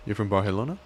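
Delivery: usual speaking voice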